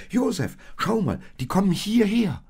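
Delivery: high, loud urgent call; high-pitched exclamation, very loud; excited calling. A narrator's reading. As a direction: shout excitedly to get attention. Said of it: "rief Maria aus".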